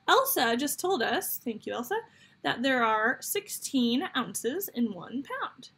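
usual speaking voice

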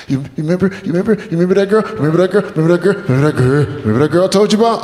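high, stuttering tearful babble; imitating a weepy friend, words tumbling out; mock crying. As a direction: keep restarting as if choked by tears. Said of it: "really crying right now?".

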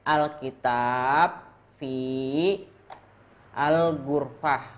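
alkitab fi al-gurfah said slowly, word by word; slow model reading. Shown as a demonstration. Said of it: "Satu ulangi lagi".

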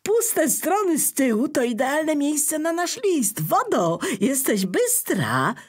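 high, loud, happy bear voice; the bear praises clever Water, delighted. Acted. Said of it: "ucieszył się Miś".

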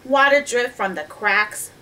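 usual speaking voice

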